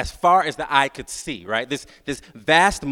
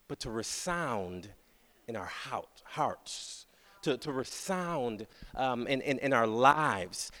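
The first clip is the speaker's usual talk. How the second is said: soft, intimate, much softer; hushed preaching; 4 semitones lower, 9 dB quieter, slower than usual. Acted. Preacher hushing to the congregation about hearts, soft and slow. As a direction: draw them in with a near whisper. Preacher drops to near hush.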